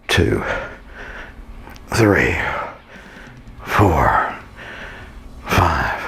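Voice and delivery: low, breathy counts pushed out between lifts; strained counting. Real, not acted. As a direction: count each rep through the effort. Counting reps during leg lifts.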